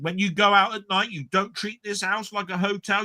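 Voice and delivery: high-pitched mock mum laying down the rules; mum scolding. Son imitating his mother forbidding him to bring women home, high mock scold. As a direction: squeak it out like a strict mother. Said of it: "my mum was turning around and saying".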